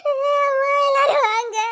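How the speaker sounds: sobbed, drawn-out calls; tearful wailing plea; crying for help. Acted. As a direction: call everyone through sobs. Crying for people to come.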